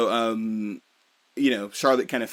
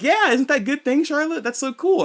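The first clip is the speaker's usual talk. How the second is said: much higher, bubbly mock excitement; gushing delight; 5 dB louder than usual. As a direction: squeal it to your friend. Anna gushing to Charlotte.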